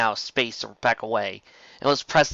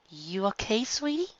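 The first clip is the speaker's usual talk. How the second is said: high soft worried question; tender worry; 10 semitones higher, 6 dB quieter than usual. Acted. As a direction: ask gently through the door. Lyra calling through door.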